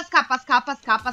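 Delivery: usual speaking voice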